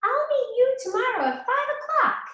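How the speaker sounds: high little pig; the pig brushing off the wolf. A narrator's reading. Pig refusing the wolf's turnips, high. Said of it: "he replied".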